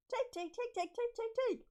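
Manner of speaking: high, eager calling of his name; high-pitched excited calling; giddy Jane voice. Acted. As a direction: call out to Jake like an overexcited girl. Voicing Jane calling Jake.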